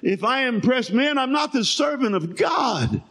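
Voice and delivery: high, shouted preaching of the verse; fiery scripture reading. Acted. Preacher proclaiming Galatians to the congregation, shouting high.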